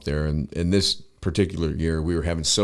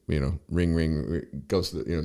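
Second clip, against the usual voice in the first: usual speaking voice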